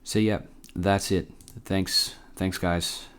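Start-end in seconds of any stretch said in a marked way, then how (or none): none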